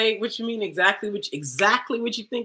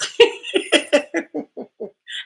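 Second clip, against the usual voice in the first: laugh; 7 semitones higher, 5 dB louder than usual. Real, not acted. Laughing at her own line.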